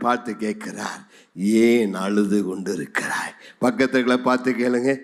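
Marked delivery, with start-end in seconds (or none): 1.7-3.4 low, hushed question; gentle question. Acted. Jesus asking the weeping one, gently. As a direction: ask it tenderly, far lower.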